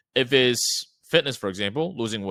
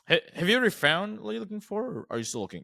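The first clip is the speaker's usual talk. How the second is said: deliberate stutter on "Ha-, have", then a rise on "ever"; natural caller stumble, curious and unscripted; curious, checking-in tone; 8 semitones higher than usual. Shown as a demonstration. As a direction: sound like you're just checking in, casually curious. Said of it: "You're looking from, like, a curious tone".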